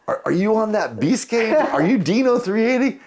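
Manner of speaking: very high, excited imitation of a fan; retold question climbing way up in pitch; starstruck fan. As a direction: stammer into amazed recognition. Retold fan realizing who he is.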